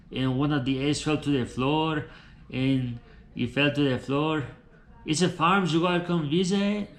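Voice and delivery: put-on Tijuana Mexican-accented English. Tijuana man tells of his farm life in Colorado, Mexican accent. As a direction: play a chatty character with a Mexican accent. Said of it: "I'm from Tijuana".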